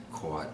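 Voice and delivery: "quat" with a rounded, gliding vowel; rounded "kwawt" for cot; alternate vowel in cot. Shown as a demonstration. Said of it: "I don't say quat".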